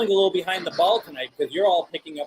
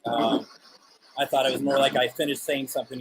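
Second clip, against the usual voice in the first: ordinary talk, nothing marked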